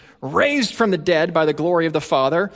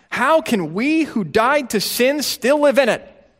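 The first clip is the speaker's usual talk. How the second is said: high, rising protest; indignant question; 8 semitones higher than usual. Retold or imitated. Paul protesting to the Romans, indignant and high. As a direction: ask it in disbelief. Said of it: "Protest is his first response".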